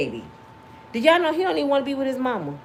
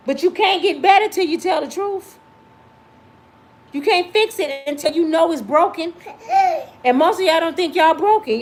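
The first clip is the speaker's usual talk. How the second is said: preaching; 5 semitones higher than usual. Real, not acted. Preaching to viewers.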